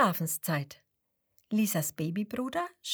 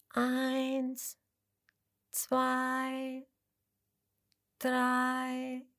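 slow counting; 4 semitones higher, much slower than usual. Acted.

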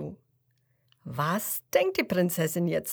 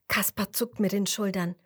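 usual speaking voice

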